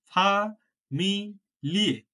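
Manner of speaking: ফা, মি, লিয়ে said slowly, one syllable at a time; split into syllables. Shown as a demonstration. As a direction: break ফা মি লিয়ে apart, slow and clear. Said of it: "ছোট ছোট করে ভাগ করা যাচ্ছে".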